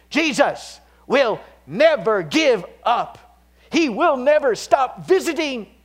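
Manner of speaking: loud, high and slow proclamation; impassioned pulpit cry; full-force preaching. Real, not acted. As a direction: shout the promise triumphantly. Sermon climax on Jesus.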